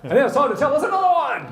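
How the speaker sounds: very loud, high announcer call; playful, over-the-top introduction; show announcer. Pretend. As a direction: holler the handover like a game-show host. Host calls up next joke teller.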